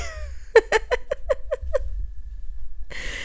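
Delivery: high, airless giggle under her breath; breathy giggle. Real, not acted. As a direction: giggle at him gaming beside you. Laughing at boyfriend playing Halo.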